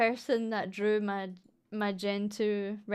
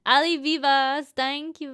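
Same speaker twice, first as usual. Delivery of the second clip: ordinary delivery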